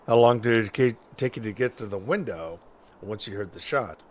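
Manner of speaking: normal talking voice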